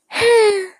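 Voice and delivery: high, loud exhaled "ahh"; loud after-drink sigh. Shown as a demonstration. Podcaster showing her habit after drinking, loud sigh. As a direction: demonstrate a big sigh after a gulp. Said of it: "I don't know why I do that weird".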